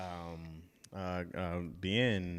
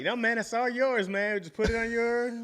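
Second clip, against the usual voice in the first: higher-pitched mock easygoing offer; imitated generous insistence; 9 semitones higher than usual. Teasing co-host with his own offer, imitated generous insistence. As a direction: imitate a friend waving away any claim. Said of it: "you were like".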